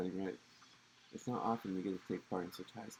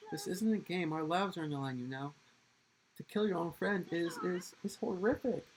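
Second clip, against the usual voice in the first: much higher, louder, near shouting in protest; high pleading shout; 9 semitones higher, 5 dB louder than usual. Acted. Student shouting at the smug heir in protest. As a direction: cry out that their lives are at stake.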